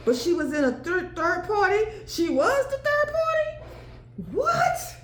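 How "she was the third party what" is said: shocked surprise. Real, not acted. Reader shocked by the third party card, high disbelief. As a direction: pitch jumps way up in disbelief.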